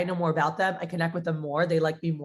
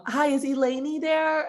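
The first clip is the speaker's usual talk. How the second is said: stranger mispronouncing name; 10 semitones higher, 3 dB louder than usual. Retold or imitated. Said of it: "my girlfriend's name would".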